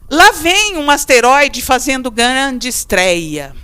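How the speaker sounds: slow, loud, careful reading of the test sentence; read aloud slowly. Shown as a demonstration. Teacher reading an exam sentence to students, slow and deliberate. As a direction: read each word out for the class. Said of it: "reads test sentence aloud".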